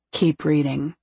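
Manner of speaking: low male command; low, controlled male character voice; man's low voice. A narrator's reading. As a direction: drop low to order her on.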